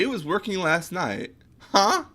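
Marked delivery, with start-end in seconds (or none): 1.6-2.0 surprised. Real, not acted. Confused by missing project file.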